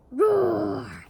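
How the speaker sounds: big loud roar; roaring monster bellow. Acted. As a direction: bellow it down at him. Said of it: "Sully bellowed down at Mike".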